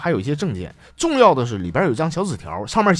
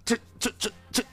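soft, stammering mock near-tears; 模仿快哭了; 8 dB quieter, faster than usual. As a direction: choke up like a distraught father, played for laughs. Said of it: "我爸都快哭了". (假装快哭出来，小声结巴)

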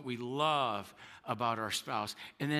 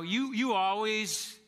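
raised, drawn-out mock complaint; a hint of a fed-up spouse, higher and louder; mock frustrated accusation; 7 semitones higher, 5 dB louder, slower than usual. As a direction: act out a frustrated spouse snapping. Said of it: "you're going".

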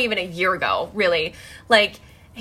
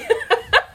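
high burst of laughter; real laugh; 3 dB louder than usual. Real, not acted. Laughs at her own plea.

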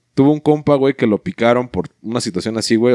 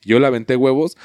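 usual speaking voice

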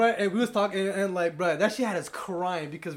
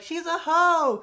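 mock girl voice; 9 semitones higher, 4 dB louder, much slower than usual. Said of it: "she was like".

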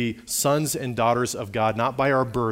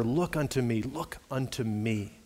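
softer, slowing appeal; tender plea; 6 dB quieter than usual. Acted. Preacher pleads with a poor sinner to look, tender and hushed. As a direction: let the repeated plea sink to a soft, slow close.

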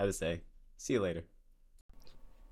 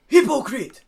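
yelled movie line; 9 semitones higher, 9 dB louder, slower than usual. Retold or imitated. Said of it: "and the guy goes".

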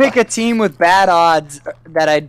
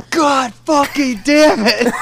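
slow, drawn-out frustrated yell; mock angry outburst; 8 semitones higher, slower than usual. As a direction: act out the rage at the computer. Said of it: "you just go".

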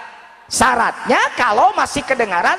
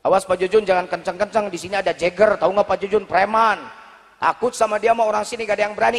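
cautioning villager; 5 semitones lower, faster than usual. Acted. Villager warns Pak Jujun about a local thug, lowered cautioning voice. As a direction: drop the voice to warn someone off. Voicing a villager's warning to the preacher.

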